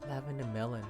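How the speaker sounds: ordinary talk, nothing marked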